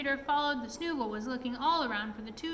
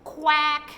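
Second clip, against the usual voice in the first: loud gloating quack, pitched up; 4 semitones higher, 11 dB louder than usual. A narrator's reading. Snoogle trapping the pixie, loud gloating quack. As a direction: quack out in triumph, much louder. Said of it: "he cried with a deep chuckle".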